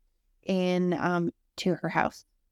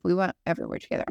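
normal talking voice

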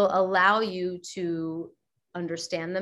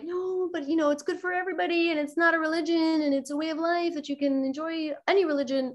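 mock pitch; 8 semitones higher than usual. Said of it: "we had to, like".

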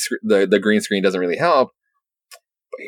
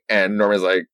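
normal talking voice

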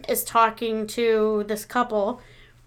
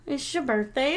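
usual speaking voice

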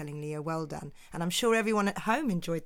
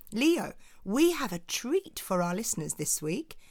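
ordinary talk

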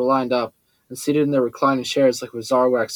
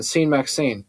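plain reading voice

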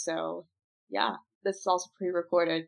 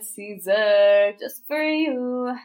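drawn-out; 9 dB louder, much slower than usual. Real, not acted. Drawn-out mock dedication.

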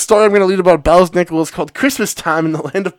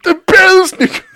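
dramatic echo; 13 semitones higher than usual. Retold or imitated. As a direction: wail the name like a ham actor.

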